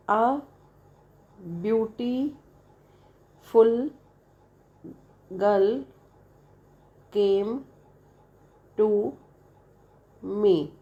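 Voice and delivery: each word said slowly and apart, with long pauses. Shown as a demonstration. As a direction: say one word at a time, as if feeling the chin drop. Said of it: "Suppose if I say".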